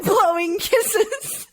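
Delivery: high, slow, giggling through it. Pretend. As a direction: laugh while blowing kisses. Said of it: "I'm, like".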